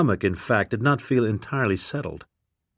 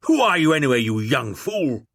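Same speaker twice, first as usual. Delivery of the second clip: put-on blustering old lord; 3 semitones higher than usual. A narrator's reading. Old lord demanding who the young man is, blustering. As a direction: bark it like an outraged old lord.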